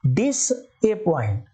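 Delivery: "डिसअपॉइंट" drawn out slowly as dis, a-point; correct pronunciation. Shown as a demonstration. Teacher stressing the extra vowel for spelling, slow split pronunciation. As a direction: stretch "डिसअपॉइंट", sounding the middle "e" clearly. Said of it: "वो ए पॉइंट है ये".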